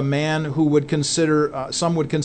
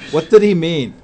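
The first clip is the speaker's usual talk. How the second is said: usual speaking voice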